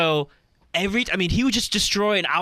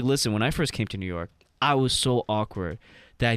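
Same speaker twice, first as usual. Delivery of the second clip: lower voice of a shy comic; 8 semitones lower, 3 dB quieter than usual. Retold or imitated. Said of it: "he's like".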